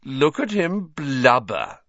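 mocking. A narrator's reading. Malfoy mocks weeping Hagrid.

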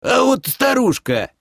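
очень высокий стариковский возглас; выкрикни находку резко вверх; дед хрюкает. A narrator's reading. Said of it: "хрюкнул дед". (дед восклицает очень высоким голосом)

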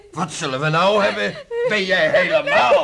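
loud, high, furious shout; outraged outburst. Acted. Father shouting at his daughter after her confession. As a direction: explode at her in disbelief.

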